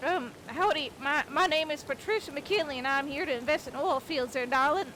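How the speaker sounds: Texan belle introducing herself slowly; Texas accent. Acted. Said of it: "I even had an accent for it".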